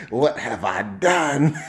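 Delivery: slow hammed-up remorse breaking into laughter; mock regret. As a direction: pretend dismay, words drawn out. Imagines his morning-after regret.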